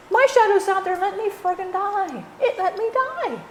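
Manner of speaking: high-pitched indignant complaint about the shadow; indignant outburst. Real, not acted. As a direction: cry out in disbelief at being let die.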